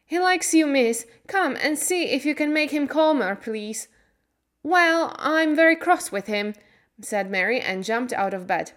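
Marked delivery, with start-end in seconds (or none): 0.1-3.9 high, anxious pleading; urgent plea. A narrator's reading. Martha begging Mary for help, urgent plea. 4.6-6.5 high, loud indignation; cross. A narrator's reading. Mary jumping out of bed, cross.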